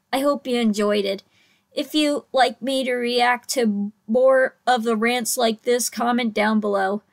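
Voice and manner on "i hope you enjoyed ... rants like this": ordinary talk, nothing marked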